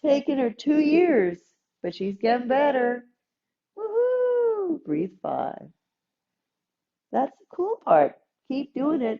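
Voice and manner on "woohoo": very high whoop of joy; cheer. Real, not acted. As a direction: whoop for her success. Cheering student's progress.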